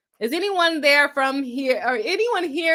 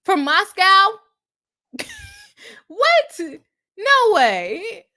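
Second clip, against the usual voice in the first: surprised; 8 semitones higher, 5 dB louder, much slower than usual. Real, not acted. Shocked by the chat's news.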